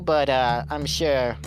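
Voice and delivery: ordinary talk, nothing marked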